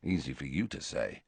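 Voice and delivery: Harry grumbling about Romilda, muttered. A narrator's reading. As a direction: mutter it under the breath. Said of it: "muttered Harry".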